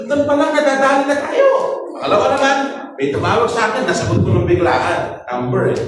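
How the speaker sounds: usual speaking voice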